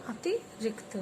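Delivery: softly modeled अतिरिक्त with short vowels; short vowel sound. Shown as a demonstration. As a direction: keep the इ in अतिरिक्त short and light. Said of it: "अब इसमें छोटी sound निकलेगी".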